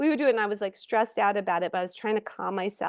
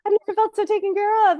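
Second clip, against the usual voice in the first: much higher and louder, gushing fast; overwhelmed gratitude; 14 semitones higher, 5 dB louder, faster than usual. Retold or imitated. Recalling feeling cared for by the Hawaii crew, gushing and amazed. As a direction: gasp it out, moved and amazed.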